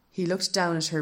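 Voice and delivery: ordinary delivery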